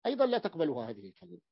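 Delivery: ordinary talk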